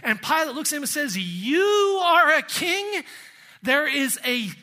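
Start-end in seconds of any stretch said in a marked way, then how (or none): 1.2-3.0 incredulous, high, loud mockery with a drawn-out YOU; mocking ridicule. Pilate sneering at the beaten prisoner before him, mocking ridicule. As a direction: scoff at the very idea, slow and high. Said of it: "definite tone of ridicule and mockery".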